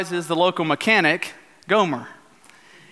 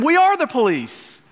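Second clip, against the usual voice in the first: higher, fed-up retort with stress on are; imitating an exasperated deputy, pitched up; 8 semitones higher than usual. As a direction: snap back in mock exasperation. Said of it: "exasperated, shoots back at him".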